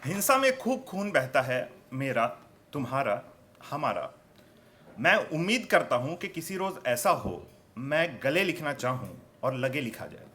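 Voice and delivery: emphatic mushaira-style declaiming; poetry recitation. Acted. Poet shares his hope with the audience, emphatic recitation. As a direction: swell into each line, ease off at its end.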